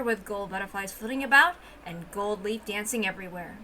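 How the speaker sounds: ordinary talk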